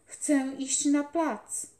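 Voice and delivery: put-on loud, slow, high plea from a sick boy; boy calling out. A narrator's reading. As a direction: call it out slowly and eagerly. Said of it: "Zawołał".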